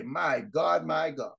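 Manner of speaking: normal talking voice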